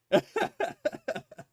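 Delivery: squeaky high laugh; high-pitched laugh. Real, not acted. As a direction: burst into a high giggle. Laughs after joke.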